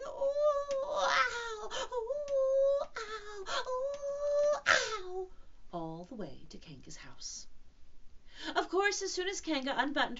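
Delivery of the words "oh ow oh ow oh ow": alternating high, drawn-out squeals; bouncing ohs and ows. Acted. Piglet bounced all the way home, high wailing cries. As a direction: wail up and down as if jolted along. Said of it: "as he was saying".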